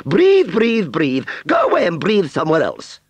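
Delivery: very high, loud, mocking dismissal; scoffing. Aunt Sally shooing the puffed Wurzel, scoffing. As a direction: screech it scornfully and send him off. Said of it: "scoffed Aunt Sally".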